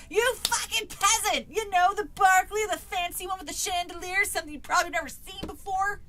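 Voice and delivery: high, loud haughty tirade; impatient cry. Acted. Exasperated girl scolds a peasant, impatient cry. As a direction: berate him, loud and high. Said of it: "I cried impatiently".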